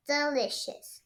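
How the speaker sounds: usual speaking voice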